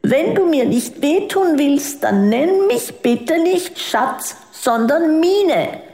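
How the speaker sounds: put-on mild tearful child pleading; sobbing child. A narrator's reading. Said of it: "Mine schluchzte".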